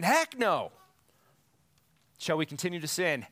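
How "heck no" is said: mock scream. Shown as a demonstration. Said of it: "It's like screaming".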